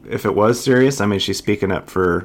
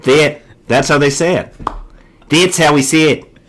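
higher Aussie voice, louder; Australian accent; 7 semitones higher, 3 dB louder than usual. Pretend. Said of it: "why do you say it like that".

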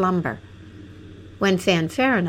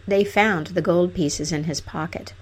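plain reading voice

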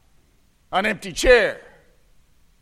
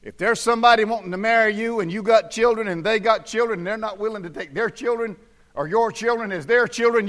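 ordinary delivery

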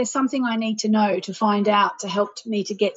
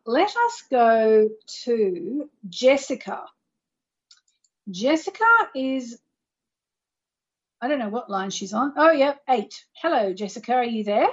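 normal talking voice

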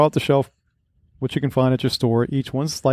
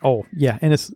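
normal talking voice

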